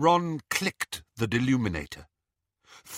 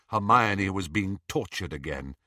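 plain reading voice

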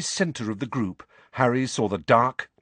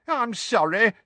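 high-pitched wailed apology; Tonks, wailing; 12 semitones higher than usual. A narrator's reading. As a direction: wail it helplessly. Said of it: "wailed Tonks".

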